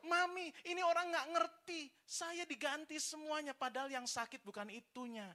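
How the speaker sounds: high, whiny complaint to mummy; tattling car voice. Retold or imitated. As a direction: play a car crying out its report. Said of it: "dia teriak seperti lapor".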